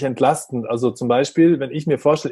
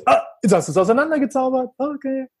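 much higher, sing-song wonder; playful delight; 10 semitones higher than usual. Retold or imitated. Mother cheering her son's bread magic, high playful delight. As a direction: marvel at the child's magic trick.

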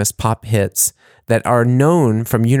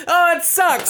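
high, laughing at his own flop; laughing groan. Real, not acted. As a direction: groan through the laugh at the failed punchline. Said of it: "I can't sell it on the audio".

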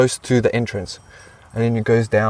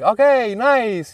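high, pleased little welcome; imitated cheery approval; 8 semitones higher, much slower than usual. Receptionist accepting the new volunteer, cheery approval. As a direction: sound pleased to receive the newcomer.

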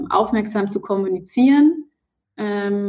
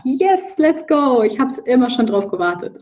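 high, bright mock cheer; mock excited; 4 semitones higher than usual. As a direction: cheer it like a delighted partner. Imagined partner cheering the news.

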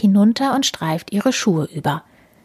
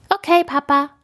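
put-on higher child voice calling; higher childlike call; 9 semitones higher than usual. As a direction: call back to Papa like a girl. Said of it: "ruft Emi".

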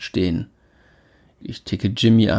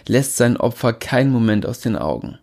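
ordinary delivery, nothing marked

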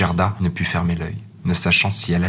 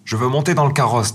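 put-on spoiled robber girl; 6 semitones higher than usual.

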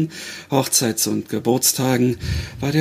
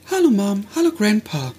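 higher, bright young daughter voicing; young girl voice; 8 semitones higher than usual. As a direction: play the young girl gently. Grace greets her dead mother.

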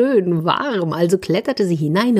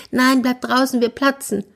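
much higher, squeaky mouse protest; mouse crying out; 8 semitones higher than usual. A narrator's reading. Mouse refusing the bear, high squeak. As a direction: cry out small and high. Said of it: "rief die Maus".

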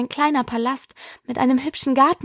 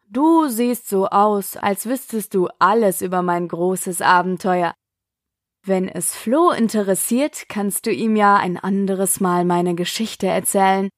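put-on a tired old lion speaking deep and calm; deep bass voice; 4 semitones lower than usual. A narrator's reading. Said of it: "mit einer tiefen, angenehmen Bassstimme".

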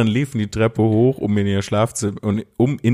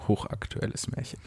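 whispered aside; 13 dB quieter than usual. Real, not acted. Repeated quietly as a joke.

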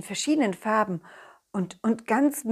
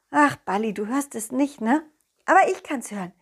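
plain reading voice

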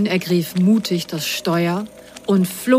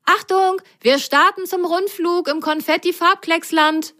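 Grasgrün calling; 12 semitones higher than usual. Acted. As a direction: call out the takeoff in a high voice. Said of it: "rief Grasgrün über die Schulter".

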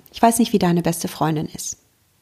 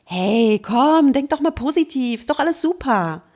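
higher, breezy fake-upbeat comfort; mock cheerful advice; 5 semitones higher than usual. Friend giving hollow chirpy advice to a sad friend. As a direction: brush her off with chirpy positivity. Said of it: "dass du zu ihr sagst".

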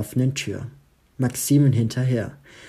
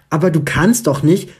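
higher, louder protest from the phone; protesting mother; 5 semitones higher, 6 dB louder than usual. A narrator's reading. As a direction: cut in, outraged, higher pitch. Mutter am Telefon protestiert. (empörte Mutter am Telefon, höher und lauter)

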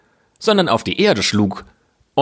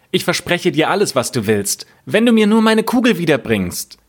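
higher-pitched, eager princess voice; 7 semitones higher than usual. Acted. Princess promising the frog anything for her ball, eager higher voice.

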